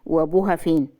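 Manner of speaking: ordinary delivery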